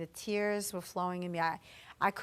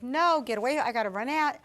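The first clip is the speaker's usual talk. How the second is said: louder, urgent brush-off; retold frantic refusal; 4 semitones higher, 6 dB louder than usual. Wife pushing off her comforting husband, retold frantic refusal. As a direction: push someone away in a hurry, acted.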